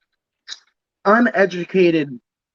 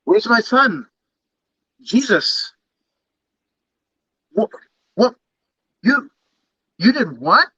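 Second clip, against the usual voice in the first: a higher, indignant God demanding answers; a mock-outraged deity, voice raised; playing God; 5 semitones higher than usual. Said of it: "He's acting like God".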